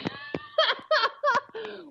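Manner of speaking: high-pitched burst of laughter; real laugh. Real, not acted. Woman laughing at her own story of a baffling workshop, high laugh.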